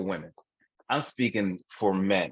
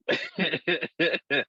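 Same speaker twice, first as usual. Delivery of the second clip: high, rapid chuckling; can't stop laughing; helpless laughter; 7 semitones higher than usual. Real, not acted. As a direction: keep giggling in quick pulses. Cracking up at the frank confession.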